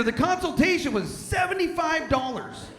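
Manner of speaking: high, loud disbelieving rant; outraged rant. Real, not acted. Comedian telling the crowd about the vet bill, loud outraged yell. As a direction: complain about the price in outrage.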